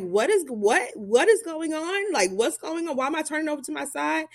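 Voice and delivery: high, confused rush of questions; startled, demanding to know what's wrong; alarmed questions. Retold or imitated. Panicked patient asking what's happening.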